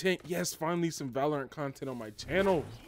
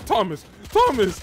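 excited yelling; 6 semitones higher, 10 dB louder than usual. Real, not acted. Gamer calling out to teammate Thomas, excited yell. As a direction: shout the name, louder and higher. Shouting teammate's name over the play.

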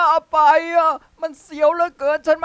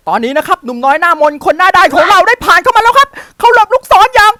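excited play-by-play, rising higher; mock sportscaster commentary building higher; sports commentary; 8 semitones higher, 4 dB louder than usual. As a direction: call it like a live sportscaster. Play-by-play of own run.

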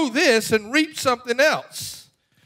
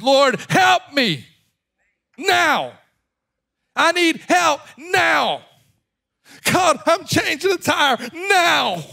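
shouted desperate prayer; 3 semitones higher than usual. Acted. Desperate believer crying out to God for help, shouting. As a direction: plead at full urgency, leaning hard on each "now". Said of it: "Sometimes it's shouting time".

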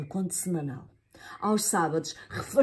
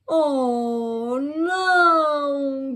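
cry out; 7 semitones higher, 5 dB louder, much slower than usual. Acted. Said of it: "gritou a Eva".

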